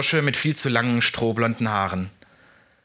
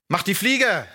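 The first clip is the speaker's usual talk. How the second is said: called out; 9 semitones higher than usual. A narrator's reading. Unseen rescuer orders the bullies away, calling out. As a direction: call it out to chase someone off. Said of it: "rief eine sehr laute Stimme".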